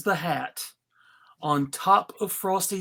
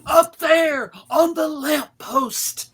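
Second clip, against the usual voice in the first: high, loud pointing-out shout; impatient shout; exasperated cop yell; 5 semitones higher, 4 dB louder than usual. Acted. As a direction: bark it at the clueless snowman. Cop yells at Frosty.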